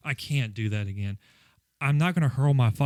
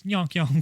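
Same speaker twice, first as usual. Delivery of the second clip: goofy honk noise; 4 semitones higher than usual. Pretend. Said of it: "yonk yonk your fucking mouth off".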